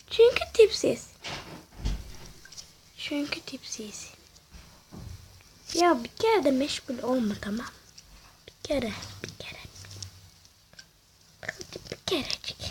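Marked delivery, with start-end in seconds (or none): 0.1-1.1 high-pitched, softer teasing answer. Pretend. Gamer answering why they're busy, high teasing. Mocking answer, then repeated under breath. 3.0-4.1 very soft whisper; whispered repeat. Pretend. Gamer repeating the answer to self, whispered. 8.5-12.6 very soft near-whisper; hushed pleading. Real, not acted. Gamer begging for just once, hushed. Pleading to self during play.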